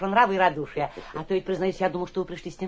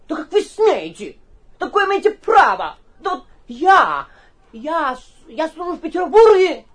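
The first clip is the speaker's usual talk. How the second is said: blustering up to a shout; 5 semitones higher, 9 dB louder, slower than usual. Acted. Guest blusters, escalating to shouting.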